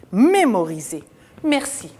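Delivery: ordinary talk, nothing marked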